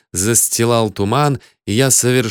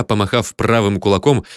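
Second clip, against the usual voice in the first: plain reading voice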